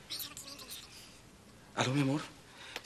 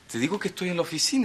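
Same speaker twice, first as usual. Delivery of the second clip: fast, impatient repeat; hurried insistence on the phone to his wife; rushed insisting; much faster than usual. Acted. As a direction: rattle it off to end the argument.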